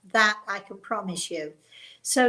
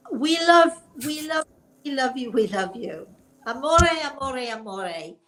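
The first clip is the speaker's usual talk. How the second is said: gushing affection; 4 semitones higher, 5 dB louder than usual. Real, not acted. Gushing at Italian viewers.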